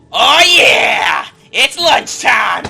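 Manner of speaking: high, drawn-out gleeful yell; full-force high war cry; goblin battle shout. Acted. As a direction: bellow it like a charging goblin. Goblin opens fire with war cry.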